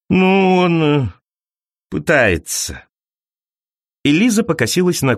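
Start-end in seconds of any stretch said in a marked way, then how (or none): 0.1-2.9 drawn-out stalling, then a dropped ending; hesitant hedge. A narrator's reading. Colleague doubting agent prevents accidents, hesitant and hedging. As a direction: stall on the filler, then concede.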